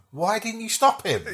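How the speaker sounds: high-pitched imitated complaint; mock dismay. Retelling everyone's reaction to a flop, mock dismay. Voicing people after a failed take.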